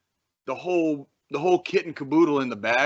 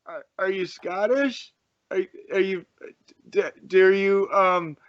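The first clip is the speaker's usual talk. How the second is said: higher, halting stammer; hesitant acted question; 6 semitones higher than usual. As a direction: act out tentative, stuttering guesswork. Acting out a hesitant question to an Aussie.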